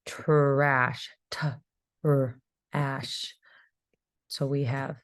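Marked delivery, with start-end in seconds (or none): 0.0-3.3 "Trash" then split into "t", "r", "ash", each sound said apart; teacher sounding out a word for first graders. Shown as a demonstration. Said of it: "help you out with that one".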